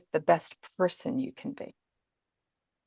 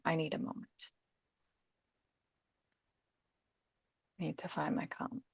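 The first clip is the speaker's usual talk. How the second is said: soft, breathy whisper to yourself, eyes closed; whispered to self; 3 semitones lower, 15 dB quieter than usual. Shown as a demonstration. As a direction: whisper it inward to find calm. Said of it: "closing your eyes for a moment and saying".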